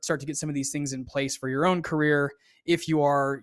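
ordinary talk, nothing marked

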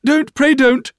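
put-on very high, loud girlish pleading; distressed cry. A narrator's reading. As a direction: beg in a high panicked voice. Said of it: "cried Dora".

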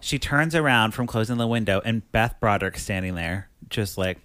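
ordinary talk, nothing marked

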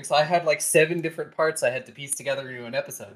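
ordinary talk, nothing marked

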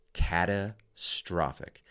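catastrophic said slowly, every syllable weighted; stress on catastrophic. Shown as a demonstration. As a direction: lean hard into catastrophic. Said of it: "I'll say it again".

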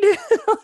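high burst of laughter; loud laugh. Real, not acted. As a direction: cackle loudly. Laughing at price.

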